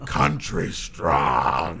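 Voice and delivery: low, very slow laughing drawl; dramatic trailer voice. Acted. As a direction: stretch the title out like a trailer. Drawn-out movie title.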